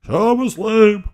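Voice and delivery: high, loud, drawn-out announcer call of SummerSlam; hammed-up announcer. Mocking WWE ads, he booms the event name like an announcer. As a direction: belt it out like a promo ad.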